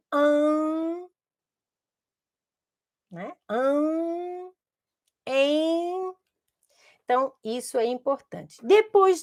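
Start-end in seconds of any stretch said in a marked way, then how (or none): 0.1-6.1 "an" and "em" drawn out through the nose; nasal vowels. Shown as a demonstration. Teacher modelling the nasal sounds for the children, long and nasal. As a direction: hum the nasal "an", then "em". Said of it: "uma palavra que tem esse som".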